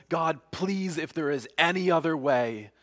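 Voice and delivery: mildly urgent, pleading prayer; earnest plea. Acted. Voices Jesus pleading in prayer.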